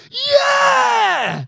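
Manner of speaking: very high, loud whoop; shrieked mock celebration; mock cheer. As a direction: cheer it in hammed-up delight. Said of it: "They don't go".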